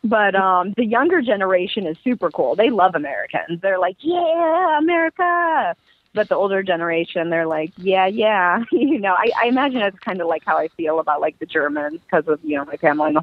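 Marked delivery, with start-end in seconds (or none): 4.0-5.8 high-pitched, drawn-out mock cheer; enthusiastic cheer. Young locals who love Americans, mock excited cheer. 7.8-8.6 unenthusiastic. Acted. Older generation to Americans, imitated lukewarm response.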